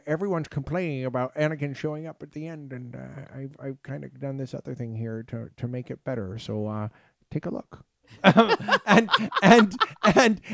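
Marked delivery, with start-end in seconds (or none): 0.1-7.8 sussurro finto di Lucas, molto più piano; hushed mock Lucas. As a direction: mumble it like a sly old producer. Voicing Lucas, softer.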